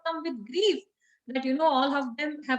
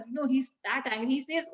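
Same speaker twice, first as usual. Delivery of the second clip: usual speaking voice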